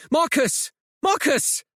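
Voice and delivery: high, desperate calling out; bellowing. Acted. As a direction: bellow his name into the wind. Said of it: "She bellows his name".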